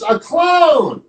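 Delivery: high, excited exclamation; story-time scream. Acted. Storyteller voices Elephant seeing the juggler, mock scream. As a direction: exclaim in storybook amazement, no real yelling. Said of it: "and screams".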